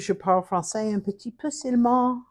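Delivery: spoken in a French accent; French apology phrasing; French phrase. Shown as a demonstration. Apologizing to viewers for her French, in French. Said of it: "I speak French only a little bit".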